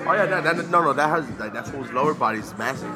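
usual speaking voice

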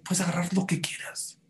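unvoiced, tempting whisper. Real, not acted. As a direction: whisper it like a secret offer. Tempting offer, store anything free.

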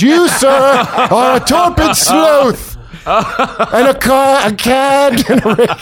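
pompous Victorian insult, slow; mock duel-challenge gentleman voice; old-timey gentleman.

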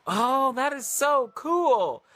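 high, loud, drawn-out gushing; slow, soaring excited exclamation; awestruck delight. Retold or imitated. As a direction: stretch it out in thrilled amazement. Retold delighted reaction to the angel.

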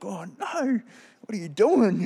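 high, loud mock alarm at his own tears; hammed-up panic at crying. Shocked to find himself crying at the altar call, high mock alarm. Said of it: "and I was like".

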